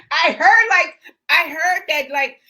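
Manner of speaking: loud, high, talking through laughter; laughing. Real, not acted. Host reacting to actress's story, laughing. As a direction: burst in while still laughing.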